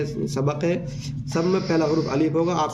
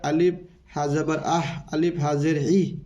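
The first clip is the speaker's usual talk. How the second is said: ordinary talk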